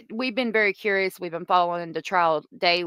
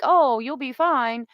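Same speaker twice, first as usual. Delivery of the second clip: drawn-out, higher-pitched mock reassurance; mock taunting; 5 semitones higher, 4 dB louder, slower than usual. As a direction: imitate someone taunting with a slow, lifted "Oh". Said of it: "she was taunting him".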